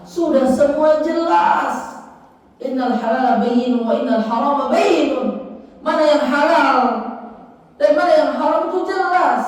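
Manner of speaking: high, drawn-out declarations; ringing, emphatic sermon voice; impassioned preaching. Acted. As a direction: proclaim it slowly and forcefully from the pulpit.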